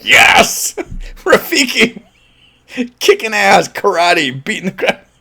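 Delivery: high, laughing excited squeal; giddy fan cheer. Retold or imitated. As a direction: cheer like a kid seeing Yoda fight.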